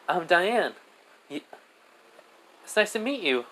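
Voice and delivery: put-on high child voice; high-pitched, friendly kid; friendly little girl. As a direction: introduce yourself as a small child. Child Diane introduces herself.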